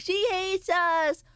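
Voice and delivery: high, slow whiny chat voice; mock sad chat. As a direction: streamer mimics sulking viewers, high and whiny. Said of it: "You guys are gonna be like".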